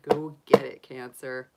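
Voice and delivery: normal talking voice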